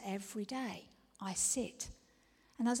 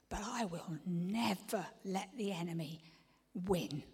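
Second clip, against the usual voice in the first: usual speaking voice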